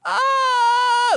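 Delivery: high, imitated kid's scream; mock panicked scream. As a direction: scream like kids running away. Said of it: "they would just run".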